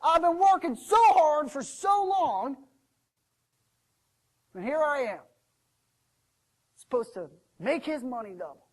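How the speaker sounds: loud, high, aggrieved rant; mad. Acted. Servant tells the stranger about his master, ranting angrily. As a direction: rant at full volume about being slighted. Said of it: "what I'm mad about".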